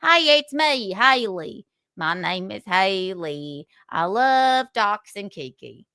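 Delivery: hammed-up Southern accent, teasing; mock Southern accent. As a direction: put on a twangy Southern accent to mock her. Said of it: "We love a good Southern accent".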